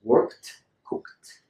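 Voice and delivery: "Worked, cooked" as workt, cookt. Shown as a demonstration. Teacher recaps the K verbs, both ending in T. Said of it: "transform this E D into a T sound".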